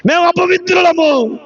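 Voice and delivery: lifted, called-out admission. Retold or imitated. Preacher repeats the spirits' confession, lifted into a call. Same confession, raised second take.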